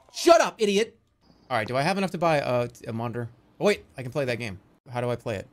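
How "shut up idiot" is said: louder, higher put-down; mock angry shout at brother; sibling snap. Retold fight with brother.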